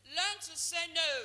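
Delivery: emphatic call. Shown as a demonstration. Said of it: "What did I say?".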